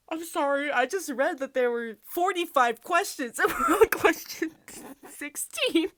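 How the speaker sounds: high, loud, helpless laughter through the words; laughing in disbelief. Real, not acted. Realizing there are forty-five questions, real laughing outburst. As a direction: burst out laughing while trying to explain. Cracks up at quiz length.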